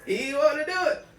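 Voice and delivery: high, loud, bark-like mock sob; mock anguished cry. Host reenacts Will Smith forced to kill the dog, barking a mock cry. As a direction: howl it like a tortured hero, hammed up. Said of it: "Why did you just bark?".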